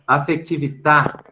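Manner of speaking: "Afectività" in Italian, slowly, stress on the final à; Italian pronunciation. Shown as a demonstration. Teacher reading the Italian word to the class, Italian pronunciation. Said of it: "no italiano".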